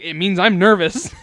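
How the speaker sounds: loud mock-kid retort; little cousin explaining. Little cousin explains what his role means, loud and emphatic. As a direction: blurt it out, on the edge of laughing. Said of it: "He's like".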